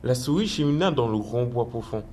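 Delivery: calm storybook narration; story narration. A narrator's reading. Narrator telling children of the mouse in the woods, calm storybook voice. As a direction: read it like a bedtime tale.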